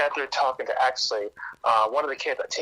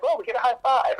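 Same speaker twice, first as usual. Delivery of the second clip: put-on higher, bright child's answer; cheerful kid; 10 semitones higher, 3 dB louder than usual. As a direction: chirp it like a little girl. Kid explains field day.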